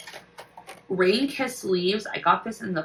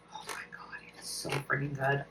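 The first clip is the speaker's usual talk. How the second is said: breathless, hushed oh my gosh; awed whisper; 3 semitones lower, 11 dB quieter than usual. Real, not acted. Vlogger sniffing a wax melt she loves, whispering in awe. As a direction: hush it out while savouring the scent.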